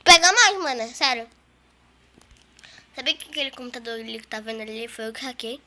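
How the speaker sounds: ordinary delivery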